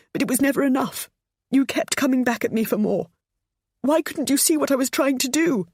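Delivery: high, tearful pleading; mother pleading through tears with her daughter-in-law. A narrator's reading. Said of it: "she said through her tears".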